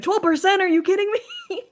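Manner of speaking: high, loud, laughing disbelief; disbelieving shout. Retold or imitated. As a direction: shriek it in laughing outrage. Retelling render disbelief.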